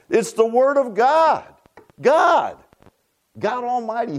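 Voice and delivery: high, slow, emphatic preacher's cry; impassioned exclamation. Real, not acted. Preacher urging the church to value God's Word, impassioned.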